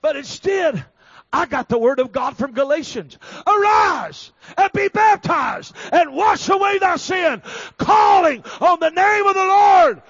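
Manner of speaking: high, loud preacher's shout; full-force preaching. Real, not acted. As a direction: proclaim the verse with drawn-out, shouted words.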